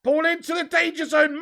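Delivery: normal talking voice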